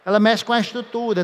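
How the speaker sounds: usual speaking voice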